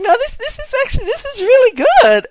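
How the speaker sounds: high-pitched, impressed insistence; retold delighted approval. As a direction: imitate him pleasantly surprised, voice lifted high. Retelling the actor's delighted reaction.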